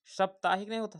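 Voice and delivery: normal talking voice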